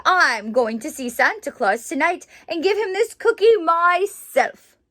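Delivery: put-on loud, excited child's boast; proud little boy. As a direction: show off your cookie proudly. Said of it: "he said proudly".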